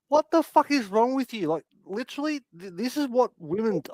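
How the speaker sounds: high-pitched, appalled retelling; retold disbelief. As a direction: act out being aghast, pitch up. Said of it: "I was sitting there going".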